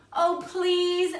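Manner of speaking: put-on children wheedling their aunties; begging. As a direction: beg the aunties like eager kids. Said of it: "asked, begged Meg and Sean".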